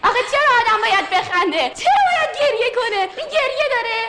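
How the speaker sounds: high, laughing disbelief; laughing protest. Real, not acted. Actor laughs at the audition, questioning it in high laughing disbelief. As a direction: protest through bursts of laughter.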